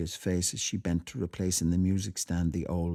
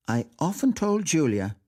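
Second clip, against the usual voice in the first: high, emphatic declaration; insistent aunt making her point; 8 semitones higher, 4 dB louder than usual. A narrator's reading. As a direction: press the point firmly, pitched well up. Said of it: "said Aunt Kate emphatically".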